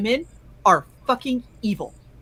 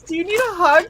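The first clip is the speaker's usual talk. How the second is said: sweet offer; 9 semitones higher than usual. Retold or imitated. Kind doctor offering the patient a hug, sweet and higher. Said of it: "And she goes".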